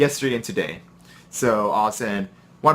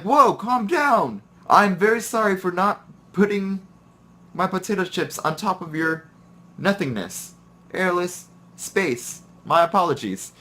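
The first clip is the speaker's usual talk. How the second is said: sarcastic apology; 8 semitones higher than usual. Retold or imitated. Sarcastic apology to angry coworker.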